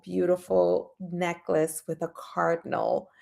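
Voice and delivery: ordinary talk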